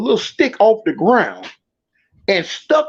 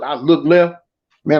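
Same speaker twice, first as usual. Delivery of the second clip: ordinary delivery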